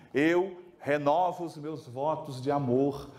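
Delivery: slow, lightly solemn wedding vow. Retold or imitated. Husband renews vows to wife before the priest: slow, mildly solemn. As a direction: renew the vows earnestly, unhurried. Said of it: "ele virou pro padre e falou assim".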